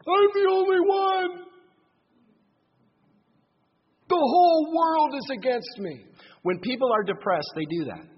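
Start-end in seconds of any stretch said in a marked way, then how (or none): 0.1-6.0 hammed-up woe, milking every word; exaggerated self-pity. Preacher mocking depressed Elijah's exaggeration, whiny and high. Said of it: "grossly over-exaggerating his problems".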